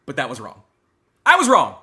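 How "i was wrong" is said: sudden loud cry of guilt; shouted confession. Acted. Streamer yelling his mock apology to chat. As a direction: yell it out like a breakdown.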